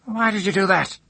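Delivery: high, loud, strained demand; shrill-pitched old woman's challenge; screeching old woman. A narrator's reading. Cursing woman, startled, questions him.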